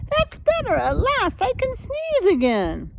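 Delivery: put-on high, loud relieved old lady. Old lady relieved her house is emptying, high and glad. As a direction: sigh it out like she can finally breathe. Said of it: "lady relieved as hen leaves".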